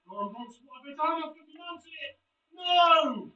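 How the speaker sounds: rising from loud to furious yell; frustrated shout. Real, not acted. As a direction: explode in disbelief. Conceding goal, frustrated outburst.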